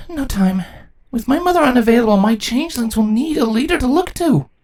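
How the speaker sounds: no time to waste; urgent resolve. Acted. Twilight insists on leading.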